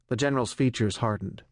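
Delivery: ordinary delivery, nothing marked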